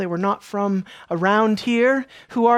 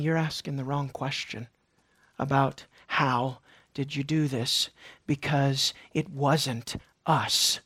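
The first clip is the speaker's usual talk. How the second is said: quiet Peter; 8 semitones lower, 9 dB quieter than usual. Pretend. Preacher voices Peter gently.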